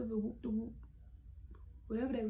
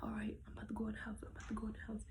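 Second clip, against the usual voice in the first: whispered reply; 7 dB quieter, much faster than usual. Retold or imitated. Told to go inside quietly.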